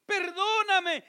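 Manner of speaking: high, slow, loud plea; a sinner at the temple begging God for pardon, loud and slow; pleading for mercy. Acted. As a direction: cry out begging forgiveness. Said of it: "ni siquiera se atrevía a levantar su mirada".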